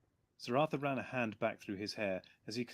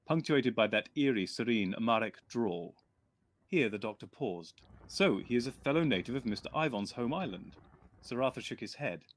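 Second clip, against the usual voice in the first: a lightly voiced, louder blunt officer reading; letter writer's voice; 8 dB louder than usual. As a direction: read it as the gruff friend's letter.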